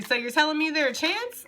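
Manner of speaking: high, hopeful meme imitation, rising at the end; meme quote. Card reader voicing viewers through a meme, high and hopeful. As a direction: quote it as an incredulous, hopeful question. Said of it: "They're giving me that meme".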